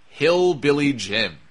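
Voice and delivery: slow, deliberate name drop; slowly savoured punchline; dramatic reveal. Real, not acted. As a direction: draw the name out for the big reveal. Slow reveal of an unlikely person.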